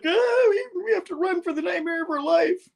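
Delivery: very high squeaky alarm; hammed-up frightened falsetto; mock panic. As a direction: squeal it like a panicking cartoon hero. Acting Peter's panicked call to flee.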